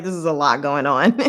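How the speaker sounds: half-laughing disbelief; laughing through it. Retold or imitated. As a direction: say it while laughing at the absurdity. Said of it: "I was like".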